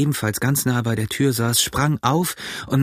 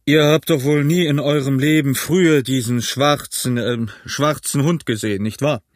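very stern; 3 semitones higher than usual. A narrator's reading. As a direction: press the man firmly for an answer. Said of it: "sehr ernst".

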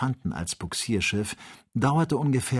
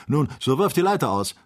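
louder, raised-pitch command; firm order; 5 semitones higher, 5 dB louder than usual. A narrator's reading. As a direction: give the order with force. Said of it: "befahl der Jäger". (lauter, bestimmter Befehl)

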